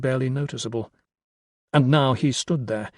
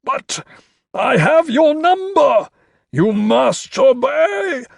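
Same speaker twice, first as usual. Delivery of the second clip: high, slow, loud screech; frantic command; 12 semitones higher, 7 dB louder, slower than usual. A narrator's reading. Carstairs claims power over Crow, screeching command. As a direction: insist like a sorcerer losing control.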